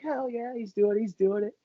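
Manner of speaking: high, softened cheer; hushed high-pitched mock hollering; buddies in the background. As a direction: imitate pals egging him on from behind. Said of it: "I hear all his buddies in the back".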